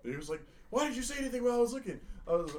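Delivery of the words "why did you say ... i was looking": high, fast indignant question; indignant teacher. Retold or imitated. Art teacher scolding the prankster, high and fast. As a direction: fire it off like an offended teacher.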